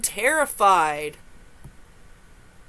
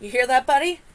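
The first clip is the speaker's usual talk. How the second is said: usual speaking voice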